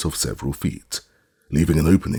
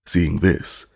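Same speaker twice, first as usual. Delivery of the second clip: usual speaking voice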